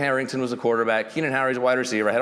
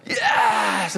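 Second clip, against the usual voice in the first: cheering fans; 9 semitones higher, 3 dB louder than usual. Retold or imitated. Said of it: "they go".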